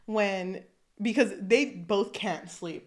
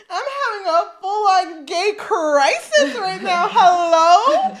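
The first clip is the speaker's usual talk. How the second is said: higher, much louder laughing scream; mock scream; 9 semitones higher, 13 dB louder, slower than usual. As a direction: yell the crisis out, half laughing. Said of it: "he screams".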